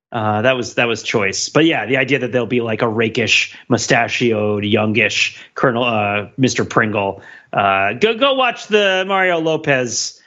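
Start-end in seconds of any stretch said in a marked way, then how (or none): none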